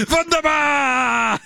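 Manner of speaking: very high, drawn-out playful yell; mock yell. Host answers how are you feeling, mock yell. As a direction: holler the answer high and long.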